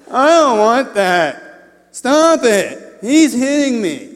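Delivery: high, loud bratty whine; whining kid. Acted. Kid complaining to parents on a road trip, high whining. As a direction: wail it like a fed-up child, stretched out. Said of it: "the kind of whine that if you're a parent".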